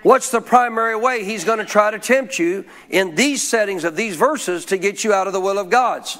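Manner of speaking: ordinary talk, nothing marked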